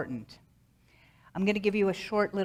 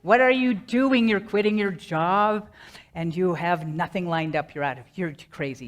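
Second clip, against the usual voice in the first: fear dragon; 2 semitones higher, 7 dB louder than usual. Acted. Inner dragon berating the speaker for quitting, loud scolding. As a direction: shout it like fear grown huge. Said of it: "those fear bunnies turned into fear dragons".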